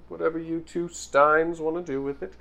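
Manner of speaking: ordinary delivery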